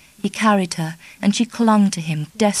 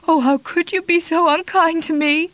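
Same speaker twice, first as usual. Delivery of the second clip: higher, louder tearful lament; sobbed; 10 semitones higher, 3 dB louder than usual. Acted. Kitty sobbing at Charlie, high tearful lament. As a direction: break down in reproach. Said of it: "she sobbed".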